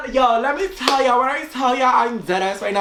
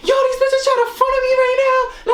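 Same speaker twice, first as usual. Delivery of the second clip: high, fast mocking phone rant; 12 semitones higher, faster than usual. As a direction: rattle it off high and indignant. Mimics her friend on the phone.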